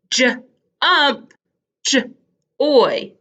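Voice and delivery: J, pause, UMP, J, pause, OY; sounded-out J. Shown as a demonstration. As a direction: isolate the j before ump and oy. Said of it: "They both make the j sound".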